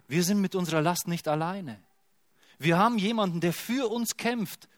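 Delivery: rising, impassioned proclamation; fervent preaching. Real, not acted. Preacher assures the church with fervent emphasis. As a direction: lift the congregation with each word.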